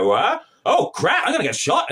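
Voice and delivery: high, panicky comic squeal; mock alarm, voice shooting up; startled deer. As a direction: play a deer suddenly panicking.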